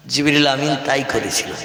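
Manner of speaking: usual speaking voice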